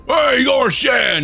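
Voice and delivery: high, slow bellow; drawn-out shout. Acted. Pirate hollering after a big gulp of rum, drawn-out shout. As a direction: holler it out, stretching the words.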